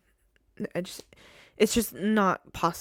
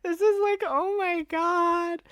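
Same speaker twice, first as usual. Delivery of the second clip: much higher, louder overwhelmed exclaim; emotional gasp; 10 semitones higher, 6 dB louder, slower than usual. Retold or imitated. Recalling crying at the coach ad, high emotional exclaim. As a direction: relive being moved to tears by the ad. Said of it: "I was like".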